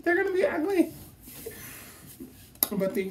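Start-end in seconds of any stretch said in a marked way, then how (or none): 0.0-0.9 high, squealing mock dread; mock panic. Retelling her night-before fear to viewers, mock panic. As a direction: squeal the nightmare worry, half laughing. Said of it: "I had anxiety dreams".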